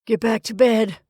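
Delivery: mildly rattled insistence; unsteady. A narrator's reading. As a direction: order it with a touch of unsteadiness. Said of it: "he said unsteadily".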